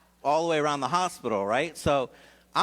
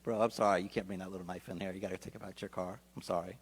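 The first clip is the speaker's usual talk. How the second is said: softer, much quieter and lower, kind and apologetic; courteous apology; 6 semitones lower, 7 dB quieter than usual. Shown as a demonstration. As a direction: show him how gently it should sound. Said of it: "You could have easily said".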